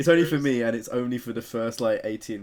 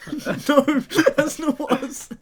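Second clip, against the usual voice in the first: laughing; 14 semitones higher, slower than usual. Real, not acted. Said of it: "laughing protest".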